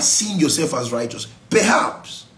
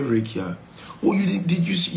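ordinary talk

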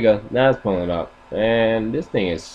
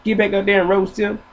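dog's dad; 10 semitones higher than usual. Retold or imitated. Dad scolding his son to roast back, high and fast. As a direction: push him to fight back. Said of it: "And your dad be like".

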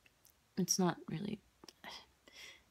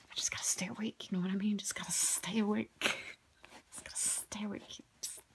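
jittery whisper; 4 semitones higher than usual. Pretend. Jittery self-mock about staying awake.

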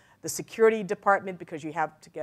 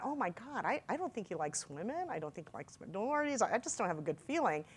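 hushed, much softer aside; uneasy inner thought; 11 dB quieter than usual. Retold or imitated. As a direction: think it aloud under your breath. Retelling her uneasy private thoughts.

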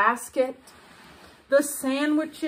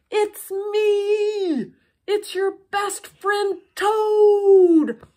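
higher, loud call across the water; shouting; 8 semitones higher, 6 dB louder, much slower than usual. A narrator's reading. Toad calling out to faraway Frog, shouting. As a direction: shout so he carries over the river. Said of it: "he shouted".

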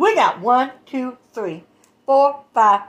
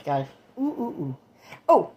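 ordinary talk, nothing marked

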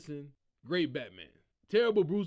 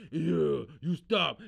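Batman voice; 5 semitones lower, 4 dB louder, much slower than usual. Retold or imitated. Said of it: "he started talking as Batman".

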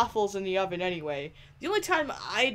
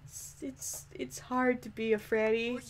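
usual speaking voice

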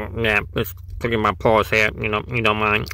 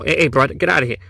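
usual speaking voice

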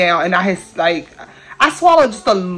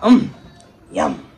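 soft, low mock yum; lightly voiced baby yum; imitating niece eating; 4 semitones lower, 4 dB quieter than usual. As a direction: mimic a baby savoring food. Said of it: "She's just like".

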